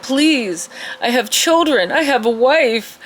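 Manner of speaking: high-pitched mock begging; hammed-up desperate plea; acted pleading. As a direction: plead for mercy as a captive. Interrogated man begs for mercy.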